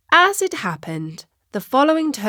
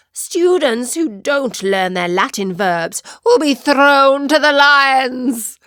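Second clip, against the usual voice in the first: roaring teacher; 3 semitones higher, 4 dB louder than usual. Acted. Strict teacher roaring back at a cheeky pupil. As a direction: roar it back at the class. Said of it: "positively roared back".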